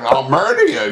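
high, squeaky mock threat. Monster mocks his cowardly partner in a squeaky high threat. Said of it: "He's like".

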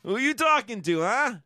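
put-on high, loud New York kid calling to the teacher; New York kid talking back in class; brash New York kid. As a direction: show off to the teacher, Bronx style.

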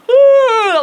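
loud, high, drawn-out yawn; exaggerated stretching yawn, put on to be noticed; big fake yawn. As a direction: let out a loud theatrical yawn. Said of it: "I'm practicing my yawn".

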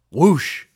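WHOOSH pushed out loud, with a jump in pitch; emphatic album title. Real, not acted. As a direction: punch the word Whoosh with a swoop. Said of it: "hoping you'd say it with some emphasis".

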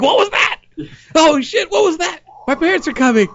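very high, squealing alarm; hammed-up frightened squeak; mock panic. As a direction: panic like a kid about to be caught. Acts teen afraid of being caught.

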